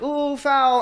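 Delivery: high sing-song mock call; mocking ref. Mocking referees calling fouls, sing-song high.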